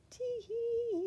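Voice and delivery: very high, gleeful little tee-hee; chortling. A narrator's reading. Mister Happy chortling to himself over his idea. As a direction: chuckle to yourself over a secret plan. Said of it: "he chortled to himself".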